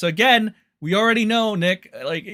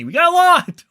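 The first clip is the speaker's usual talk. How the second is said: gleeful shout, much higher and louder; excited; 11 semitones higher, 9 dB louder than usual. Real, not acted. Streamer hyping chat about the glorious clips, excited shout. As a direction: burst out with delight over the pile.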